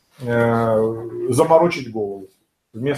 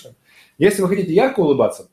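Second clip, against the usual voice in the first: usual speaking voice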